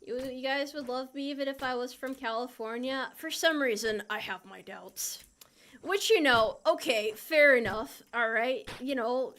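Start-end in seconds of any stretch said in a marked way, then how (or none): 4.1-5.2 soft whispered aside, doubtful. Real, not acted. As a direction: breathe it as a secret doubt. Aside to chat.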